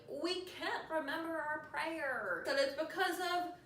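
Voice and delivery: hammed-up creepy twins; terror twins. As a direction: drag it out high like accusing kids. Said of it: "Terror twins, are like".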